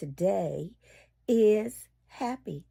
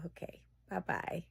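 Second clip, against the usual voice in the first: whisper; 2 semitones lower, 13 dB quieter, faster than usual. Real, not acted. Whispered goodbye.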